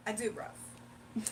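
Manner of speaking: slow, whispered mock English accent with bruv. Answering a viewer with a whispered mock English accent. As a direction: breathe I do, bruv in a lazy London accent. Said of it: "I absolutely do have an English accent sometimes".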